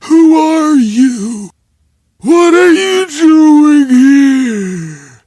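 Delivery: high, loud, slow yell; furious shout. Retold or imitated. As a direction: bellow at the intruder. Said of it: "he had said".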